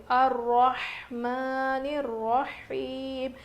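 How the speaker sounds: slow, drawn-out recitation voice; tajweed recitation. Shown as a demonstration. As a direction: recite it as a model for students. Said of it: "ہا کی آواز بالکل ظاہر ہونی چاہیے". (تلاوت کے انداز میں آہستہ پڑھنا)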